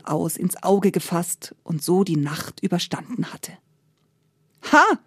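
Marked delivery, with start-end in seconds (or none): 4.7-5.0 short triumphant exclamation; storyteller's acted "gotcha" call. A narrator's reading. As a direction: pounce on it with a sudden cry. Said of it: "rief da der reiche Händler".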